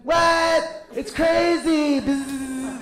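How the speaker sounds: very high, loud buzzing bee-like voice; Aziz Ansari. Acted. Comic mocking a man who sounds like a bee, high buzzy squeal. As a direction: squeal it high with drawn-out words and a buzz. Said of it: "he just sounds like a bee".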